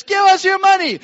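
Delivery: high, loud demanding yell; thieves' shout. Retold or imitated. Robber shouting back at the couple, loud and high. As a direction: bark the demand like a crazed robber. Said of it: "they're shouting at us".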